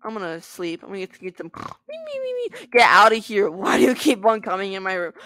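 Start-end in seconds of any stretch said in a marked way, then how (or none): 1.9-2.7 very high singsong me; squeaky high voice. Real, not acted. 2.7-5.1 loud playful telling off; mock angry. Yelling at intruder.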